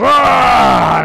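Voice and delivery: loud, high startled bear yell; bear roar. Acted. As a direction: let out a big hammed-up bear cry. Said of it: "and the bear goes".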